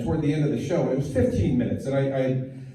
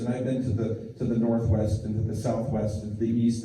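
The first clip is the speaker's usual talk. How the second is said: ordinary delivery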